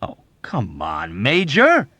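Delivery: exasperated explosion; exploding. A narrator's reading. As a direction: burst out in exasperation. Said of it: "Yossarian exploded".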